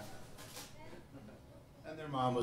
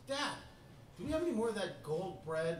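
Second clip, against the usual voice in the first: put-on enthusiastic little boy; 6 semitones higher, 5 dB quieter than usual. Son asking dad for more gold bread, enthusiastic. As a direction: imitate a keen six-year-old asking for more. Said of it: "Ian was always very enthusiastic".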